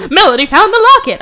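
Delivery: very high, loud shocked cry; hammed-up villain astonishment; mock shock. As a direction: blurt out a huge discovery. Villain gasps at discovery, retold.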